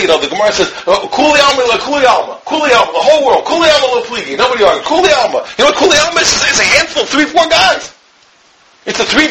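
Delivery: high-pitched, insistent preaching; impassioned preaching. Real, not acted. Preacher telling students we're all one team, impassioned.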